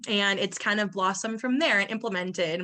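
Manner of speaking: ordinary talk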